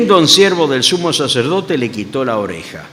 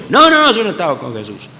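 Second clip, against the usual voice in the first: hurried nervous denial; a hint of panicked denial; flustered denial; 4 semitones higher than usual. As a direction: Peter caught out, brushing it off. Peter's second denial acted.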